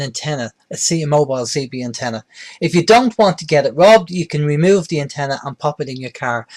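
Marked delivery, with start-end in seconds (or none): none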